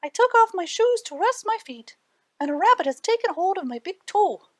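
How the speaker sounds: high, urgent little man's voice; little man pleading. A narrator's reading. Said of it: "cried the little man".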